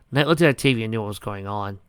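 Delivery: ordinary delivery, nothing marked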